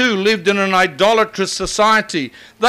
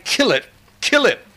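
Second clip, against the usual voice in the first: "Kill it, kill it" pressed out in a higher voice; a raised, insistent "kill it"; emphatic quote; 4 semitones higher than usual. Retold or imitated. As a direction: hammer "kill" twice like a command. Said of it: "My Indian Bible used to say".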